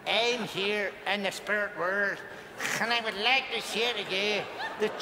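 laboured, mock-slurred Northern Irish-Mexican voice; stroke-slurred impression. Medium imitating dad after a stroke, laboured accented voice. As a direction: struggle through the words in a muddled accent. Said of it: "He'd had a stroke!".

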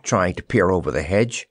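plain reading voice

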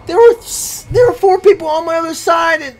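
high, loud, incredulous complaint; indignant protest. Real, not acted. Gamer outnumbered by four enemies, indignant high protest. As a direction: protest loudly, pitch shooting up. Protests being overwhelmed in game.

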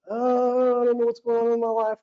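high, fast, lightly voiced confused daughter; mock confused daughter. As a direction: toss it off like a muddled teen. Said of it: "kind of things".

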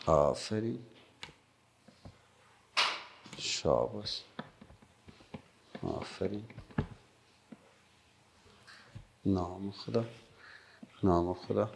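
soft, low murmured approval; hushed, low-pitched well done; quiet praise. Real, not acted. Praising children one by one as drawing.

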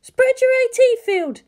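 high woman's voice over the radio link; Misato voice. A narrator's reading. Misato over the comm link, steady high voice. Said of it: "Misato spoke over the link".

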